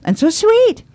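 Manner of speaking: high, swooning mock tourist; high gushing imitation of a charmed lady. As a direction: coo it like a delighted visitor. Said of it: "they found everything so".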